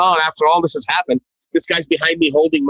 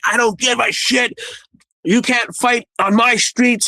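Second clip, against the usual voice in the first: mock angry, higher-pitched cop voice; higher, drawn-out mock outrage; retold angry sergeant; 8 semitones higher, slower than usual. Retold angry sergeant roughing him up.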